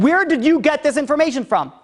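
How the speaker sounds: high-pitched, insistent questioning; urgent, acted interrogation; stern demand. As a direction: act out a king demanding an answer. Serious king demands the source.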